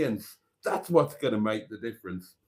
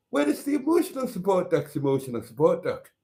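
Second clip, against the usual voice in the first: put-on higher, quick go at a lilting Scottish accent; attempted Scottish accent; 5 semitones higher, faster than usual. Host imitating the duck's duck, attempted Scottish accent. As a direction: have an unsure stab at a Highland Scots lilt. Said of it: "Oh God, that's not Scottish".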